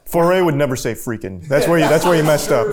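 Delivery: fast, loud, laughing tease; laughing callout. Real, not acted. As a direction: crack up while calling him out. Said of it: "player calls out the slip".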